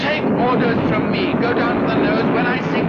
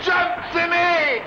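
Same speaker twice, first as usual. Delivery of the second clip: higher, slow urgent shout to jump; urgent call; 7 semitones higher, slower than usual. Acted. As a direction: yell the order over the noise. Pilot orders crewman to bail out.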